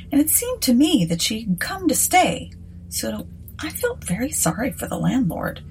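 ordinary delivery

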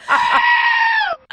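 shrieking laugh. Retold or imitated. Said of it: "Jag bara".

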